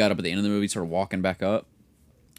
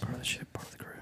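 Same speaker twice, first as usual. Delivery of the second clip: soft whispered chant; whispering; 4 semitones higher, 13 dB quieter, faster than usual. Acted. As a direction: breathe it out eerily. Whispered catchphrase again.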